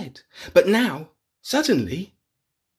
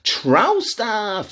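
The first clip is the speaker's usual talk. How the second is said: higher, cheeky troll voice; 8 semitones higher, 3 dB louder than usual. Acted. Little troll suggesting mischief.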